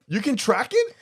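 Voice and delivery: very high, loud disbelieving question; incredulous. Real, not acted. Husband still cannot believe periods are trackable, incredulous. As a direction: repeat it in open disbelief, pitch soaring.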